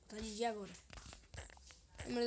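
ordinary talk

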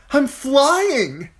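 high, loud cry of joy; delighted cry. A narrator's reading. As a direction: shout it like a boy soaring. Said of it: "he cried out in delight".

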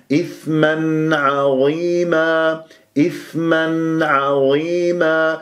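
slow recited إثما عظيما with audible n before ayn; clear nun. Shown as a demonstration. Teacher modelling izhar, clear nun in إثما عظيما. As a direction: sound the n ending إثما cleanly, then hold عظيما. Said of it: "لازم تسمع صوت النون المظهرة".

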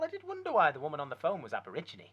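normal talking voice

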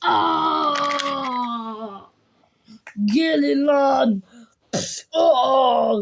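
slow taunt between pained aa cries; brawl yells. Pretend. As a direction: yell through the fight, drag out the dare. Mock brawl, taunting enemies. (kavga bağırışları)

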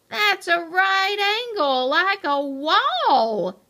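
loud, high objection stretching out at the end; alarmed protest. A narrator's reading. Mo objects to the upright ramp, alarmed and loud. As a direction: protest loudly, drag out the last word.

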